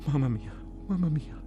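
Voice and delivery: high, pained cry of a dying soldier; wounded man moaning. Acted. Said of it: "qualcuno vicino a me che diceva". (lamento acuto di un ferito)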